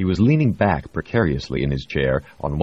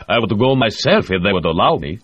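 higher, louder keen Russian delegate; eager Russian official; 6 semitones higher, 6 dB louder than usual. A narrator's reading.

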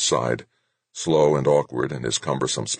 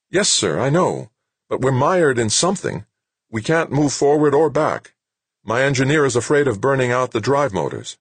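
high-pitched, insistent report; high-voiced subordinate explaining trouble; puzzled sergeant; 9 semitones higher, 3 dB louder than usual. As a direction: plead the case to a superior, voice raised in pitch. Sergeant reporting being stuck.

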